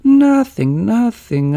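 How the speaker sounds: high loud flustered nothing; nervous denial. A narrator's reading. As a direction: blurt it out in a panic. Fred protests nervously.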